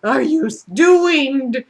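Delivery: high, loud what-are-you-doing; high-pitched disbelief at the creepy man; incredulous. Retold or imitated. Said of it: "it's like".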